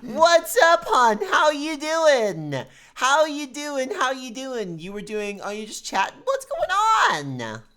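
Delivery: loud, high, slow country western drawl; terrible country western accent. Acted. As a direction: ham up a cowboy greeting. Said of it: "a terrible country western accent".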